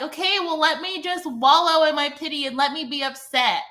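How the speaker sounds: a retold, huffy brush-off; sarcastic mad retort. Sulking at the friend who tries to comfort her, sarcastic. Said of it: "I was so mad".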